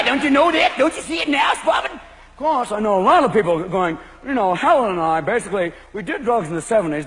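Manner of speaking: ordinary delivery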